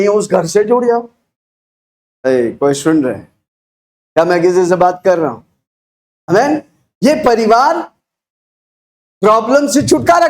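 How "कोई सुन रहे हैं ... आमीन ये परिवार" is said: ordinary delivery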